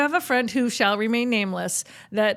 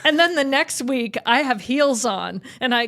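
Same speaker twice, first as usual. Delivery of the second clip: usual speaking voice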